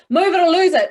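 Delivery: forceful. Shown as a demonstration. Coach contrasting two versions of the sentence. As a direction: push it out strongly. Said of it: "compared to".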